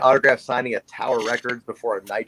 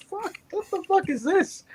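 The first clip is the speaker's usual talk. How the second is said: much higher, squeaky "what the fuck is this"; shocked disbelief; 3 dB quieter than usual. Retold or imitated. Seventeen-year-old fan reacting to Flair's snub, squeaky shock.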